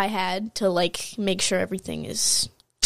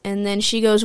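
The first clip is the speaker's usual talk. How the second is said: ordinary talk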